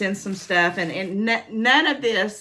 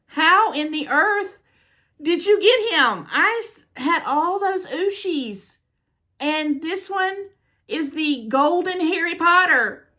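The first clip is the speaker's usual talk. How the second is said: high, amazed, drawn-out excitement; real squealing; 10 semitones higher, slower than usual. Real, not acted. Showing viewers the rare gift, squealing. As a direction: squeal in disbelief, peaking at the end. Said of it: "now that I've squealed".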